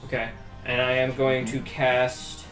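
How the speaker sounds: normal talking voice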